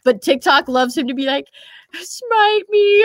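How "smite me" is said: sedated, high cartoon voice; Carl Wheezer. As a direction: stretch it out high like a slowed-down cartoon. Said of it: "they really slowed him down".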